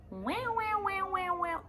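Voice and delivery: high wailing wow wow of the school alarm; alarm imitation. Teacher mimicking the school alarm: high siren wail. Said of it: "as I get into the school".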